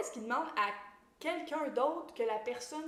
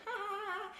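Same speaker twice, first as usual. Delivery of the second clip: sarcastic fake laugh; 11 semitones higher than usual. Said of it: "il est comme".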